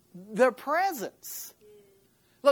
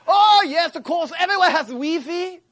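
much higher, louder, cheery shopkeeper voice with a laugh; mock French shopkeeper; 8 semitones higher, 9 dB louder than usual. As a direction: play the delighted clerk who finally understands. French shopkeeper answers about Wi-Fi.

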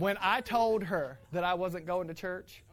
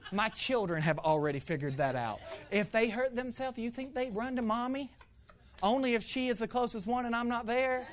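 ordinary talk

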